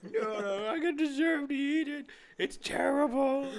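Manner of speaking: high, whiny, breaking into a laugh; high-pitched whiny complaint dissolving into a laugh; squeaky whiny voice. Acted. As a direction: whine it high and drag out the end, laughing. Acted character eating cat poo.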